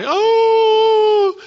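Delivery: loud high fake sob; hammed-up fake crying cry; mock wail. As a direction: ham up a pretend crying wail. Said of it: "he'd pretend he was crying".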